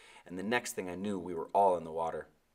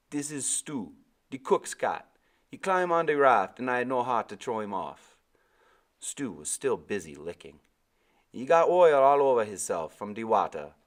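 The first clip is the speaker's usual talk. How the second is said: higher, louder island sailor voice; old West Indian sailor; 4 semitones higher, 8 dB louder than usual. A narrator's reading. Sailor explaining the boy's head injury. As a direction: speak as the big old deckhand.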